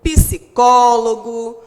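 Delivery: "psicólogo" slow and loud, heavy on the có; stress on CÓ. Shown as a demonstration. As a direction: stretch and punch the có in "psicólogo". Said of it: "palavras em que a sílaba tônica". ("psicólogo" devagar e alto, com ênfase no có)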